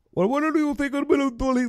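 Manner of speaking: high put-on Middle Eastern accent. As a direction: impersonate a Middle Eastern interviewer. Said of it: "You trying to do a Middle Eastern accent?".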